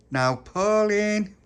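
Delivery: long, high, loud singsong call. A narrator's reading. Little Pappy knocking for Pauline, calling out. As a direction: stretch the name out as you call for a friend.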